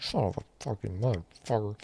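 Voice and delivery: a hint of a movie gangster's sputtering curse; lightly voiced burglar swearing in frustration; Joe Pesci cursing. Scraping melted plastic, mildly imitating a cursing burglar. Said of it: "I'm like Joe Pesci in Home Alone".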